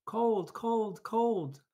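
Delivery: put-on high, loud shivery baby voices; cold little chicks complaining; shivering babies. A narrator's reading. Shivering babies tell Jack, high whine. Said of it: "said the shivering babies".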